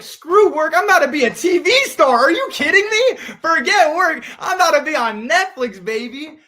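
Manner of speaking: very high, loud, gleeful yelling; telling mom to forget work, giddy; excited shout. Retold or imitated. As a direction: brush off work in thrilled disbelief. Retold reply to mom about work.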